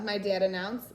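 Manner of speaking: plain reading voice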